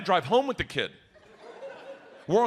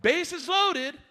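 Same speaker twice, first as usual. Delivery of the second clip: high, projected coach voice; pitched-up singsong coach announcing a drill; coach calling out; 10 semitones higher, slower than usual. Acted. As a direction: call the play across the ball field. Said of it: "Coach is like".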